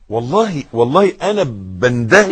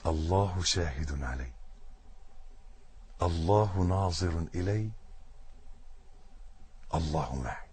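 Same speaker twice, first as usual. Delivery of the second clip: very soft and slow; preacher demonstrating a silent heart prayer, softly; 8 semitones lower, 14 dB quieter than usual. Shown as a demonstration. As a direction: breathe it inwardly, barely voiced. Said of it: "وتقول في قلبك".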